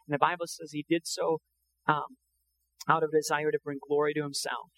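ordinary talk

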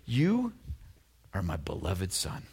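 soft, slow loving words; tender father. Acted. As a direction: speak it like a proud father blessing his son. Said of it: "Father God says".

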